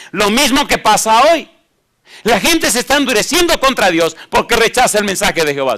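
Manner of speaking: loud, rising preacher's voice; fiery preaching. Acted. Preacher pressing the congregation, at full force.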